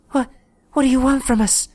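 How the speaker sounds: put-on terrified girl stammering a question; quivering voice. A narrator's reading. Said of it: "in a quivering voice".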